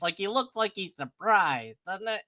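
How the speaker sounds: ordinary talk, nothing marked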